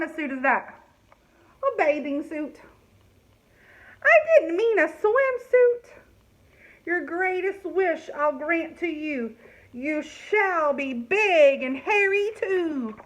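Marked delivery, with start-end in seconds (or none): 4.0-5.9 high, loud, slow fairy dismay; fairy squealing at her mistake. Acted. 6.8-13.0 spell rhyme. Acted. Fairy rhyming spell.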